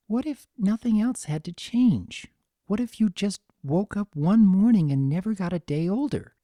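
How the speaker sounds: lightly voiced earnest Fluttershy. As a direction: press the question, gently but insistent.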